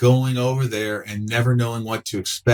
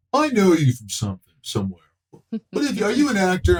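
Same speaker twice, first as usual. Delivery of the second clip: pitched way up, squinting, half-recognizing; curious stranger; 8 semitones higher than usual. Retold or imitated. Stranger squinting at a half-known face, a touch higher. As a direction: mimic a fan who can't place a face. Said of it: "As long as people don't go".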